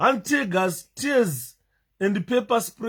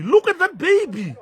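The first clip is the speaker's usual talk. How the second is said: shocked cry; 10 semitones higher, 4 dB louder than usual. Real, not acted. Repeats in shocked disbelief.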